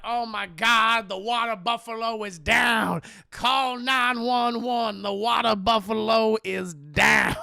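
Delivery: loud, slow, drawn-out holler; mock alarm. Joe seeing Madea fall downstairs, loud stretched mock alarm. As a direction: bellow it like a hammed-up emergency announcement.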